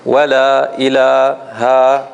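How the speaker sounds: Wa la ilaha stretched slowly with even vowel lengths; elongated recitation. Shown as a demonstration. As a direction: draw out Wa la ilaha, every mad the same length. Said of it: "like this".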